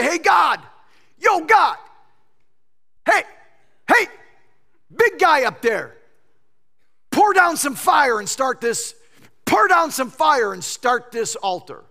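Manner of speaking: hammed-up brash plea for fire; mock shouted prayer. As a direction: holler at God like a pushy crowd.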